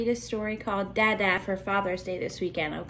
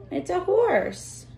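slow sing-song answer; 8 semitones higher, much slower than usual. Pretend. Playful answer to children.

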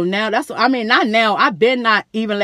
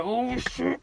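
stunned hush; 3 semitones higher, 10 dB quieter than usual. Retold or imitated. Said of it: "I'm like".

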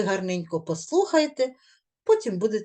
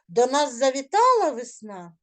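завітала stressed with a falling end; 3 semitones higher than usual. Shown as a demonstration. Teacher models the correct reading for pupils, завітала stressed. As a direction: lean on завітала. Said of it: "я вам розкажу, як треба було прочитати".

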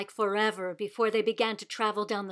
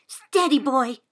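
steady the horse with a sudden loud call; urgent command; 3 semitones higher, 8 dB louder than usual. A narrator's reading. Rider calms jerking horse.

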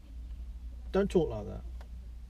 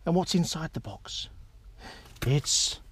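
ordinary delivery

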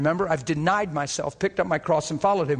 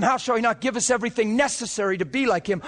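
ordinary talk, nothing marked

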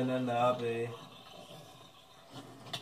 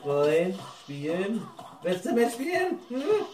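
high coaxing voice; 5 semitones higher, slower than usual. Pretend. Coaxing a creeper to play.

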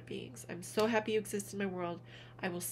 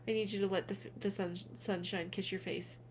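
ordinary talk

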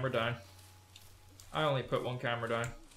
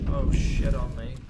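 frightened; 9 dB louder than usual. Real, not acted. Fright in dark game.

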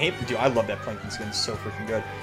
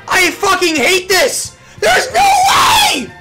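very high, loud, drawn-out screaming; rage scream; 12 dB louder, much slower than usual. Real, not acted. Streamer raging after a loss, furious scream. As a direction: scream it in full gamer rage.

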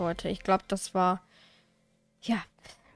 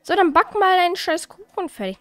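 loud, higher annoyed command; irritated; 8 semitones higher, 6 dB louder than usual. Real, not acted. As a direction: bark it at the Sim, fed up. Annoyed at Sim baking cake.

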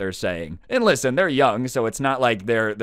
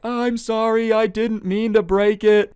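put-on much higher and louder, slowed, childish pleading; a caught kid begging his way out; whiny kid apology; 11 semitones higher, 5 dB louder than usual. Kid apologising to the cousin he wronged, whiny pleading.

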